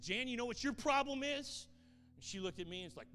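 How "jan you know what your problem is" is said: high, loud challenge; high, loud retold challenge to a grieving woman; loud confrontation. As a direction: call her out, booming.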